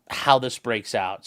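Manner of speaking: usual speaking voice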